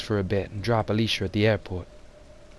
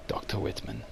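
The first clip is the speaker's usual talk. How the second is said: a veiled threat, breathed out; hushed warning whisper; menacing whisper; 7 dB quieter than usual. Acted. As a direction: whisper the name, cold and quiet. Threatening the doctor by name.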